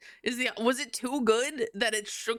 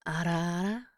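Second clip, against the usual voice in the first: put-on lightly breathy teasing older-woman voice; teasing ara ara; 5 semitones lower, 3 dB quieter than usual. Streamer teases chat with a lightly put-on mommy coo. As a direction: coo it like a teasing big sister.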